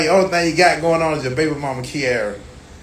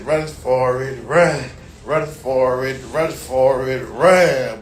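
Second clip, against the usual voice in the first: slow drawn-out call; 4 semitones lower, much slower than usual. Retold or imitated. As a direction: drag out run for it slowly.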